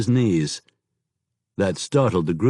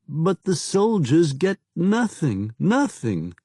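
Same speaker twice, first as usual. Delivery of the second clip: slow, higher, lightly voiced penitent; pleading southern informer; 7 semitones higher than usual. A narrator's reading. Kneeling informer confessing betrayal to his lord, slow and higher. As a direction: confess as if begging forgiveness.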